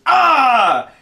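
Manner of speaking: sudden high shouted "Ah!"; imitating a kid's battle cry; kid's yell. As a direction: burst out yelling like a charging kid. Kid charges out screaming.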